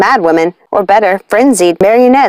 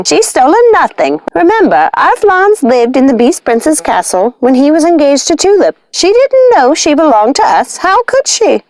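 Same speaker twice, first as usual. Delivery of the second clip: higher witch voice, reasoning; higher calm witch voice; sensible witch; 7 semitones higher than usual. Acted. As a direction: soothe the sisters in a witch's lilt. Lucinda calming sisters, witch voice.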